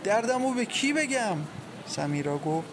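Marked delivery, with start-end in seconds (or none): 0.0-1.4 higher-pitched lament, climbing; wailing. A narrator's reading. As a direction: appeal to God in anguish. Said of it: "زجه زد و گفت".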